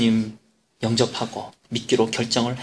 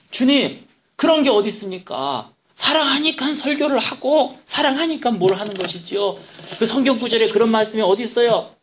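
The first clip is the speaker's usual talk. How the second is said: indignant protest; 8 semitones higher than usual. Retold or imitated. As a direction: push back at God, voice climbing. Pastor argues back with God. (주님께 훨씬 높고 크게 따지듯 항변)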